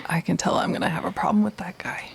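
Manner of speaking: fast unvoiced whisper; whispered aside. Real, not acted. Player whispers to the table about the lawman. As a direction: whisper it behind his back. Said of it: "player whispers aside".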